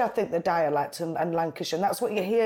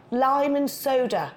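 "Lime and soda" said slowly and higher in a put-on Bristol accent; Bristol-style order; 5 semitones higher, slower than usual. Northerner finally orders at a Bristol bar in a slow put-on Bristol accent. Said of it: "And then I just decided, I went".

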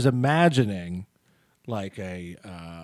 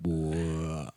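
guttural noise; 9 semitones lower, 6 dB quieter than usual. Retold or imitated. Repeating the weird noise.

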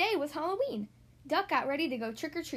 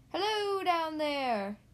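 slow, higher, drawn-out call; 4 semitones higher, much slower than usual. A narrator's reading. Squirrel hails Duck and Goose below, calling down. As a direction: sing it out from up a tree. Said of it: "called a squirrel".

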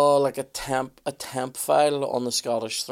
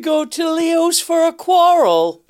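put-on Belfast accent; 8 dB louder than usual. Repeats auntie's Leo's line.

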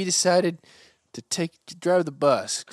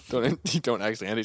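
normal talking voice

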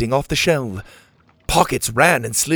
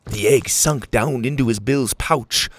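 ordinary delivery

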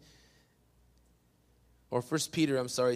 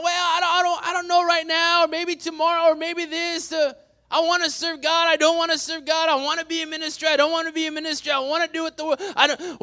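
high, loud wavering back and forth; flip-flop in a worked-up rush; mock indecision; 11 semitones higher, 8 dB louder than usual. Preacher mimicking half-committed believers, mock dithering.

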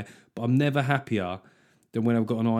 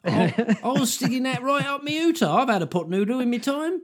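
much higher put-on Cockney accent, comic; hammed-up Cockney; 11 semitones higher than usual. Co-host mocks a middle-class suspect in a much higher Cockney voice. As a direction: play a posh coke user faking a Cockney voice. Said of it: "just me doing a Cockney accent".

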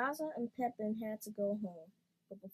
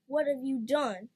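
louder, higher accusing cry; shouting; 4 semitones higher, 7 dB louder than usual. A narrator's reading. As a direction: shout at her brother, fed up. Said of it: "She stormed in and shouted".

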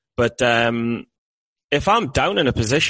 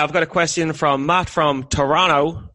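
normal talking voice

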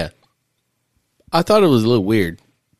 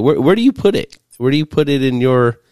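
excited question; 6 semitones higher, 5 dB louder than usual. Real, not acted.

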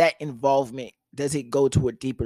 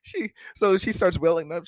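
normal talking voice

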